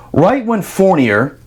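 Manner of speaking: ordinary talk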